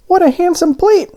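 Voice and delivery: very high, eager little donut voice. A narrator's reading. Arnie the donut on the tray, excited and high. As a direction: squeak it out with childlike excitement. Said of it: "Arnie said to himself".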